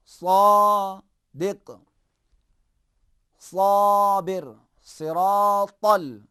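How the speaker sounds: slow, loud drill of the heavy s in صادق; heavy saad words. Shown as a demonstration. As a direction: stretch each word, making the s heavy. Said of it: "سین کو ذرا موٹا کریں".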